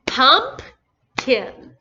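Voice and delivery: Pumpkin in two beats, PUMP loud, then kin; teacher repeating Pumpkin syllable by syllable; broken into syllables. Shown as a demonstration. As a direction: pause in the middle of Pumpkin. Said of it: "Let me say that again".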